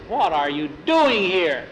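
slow, loud, emphatic question; exasperated demand. A narrator's reading. Tom finds the boys in his closet, exasperated demand.

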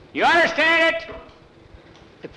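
high, loud demand for an answer; older boy shouting at the greenhorns, loud and high; bully yelling. Acted. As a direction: bark it at the new kids.

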